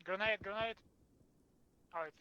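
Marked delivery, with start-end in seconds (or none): 0.0-0.8 urgent raised callout; grenade warning yell. Real, not acted. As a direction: warn the squad fast. Calling out incoming grenade.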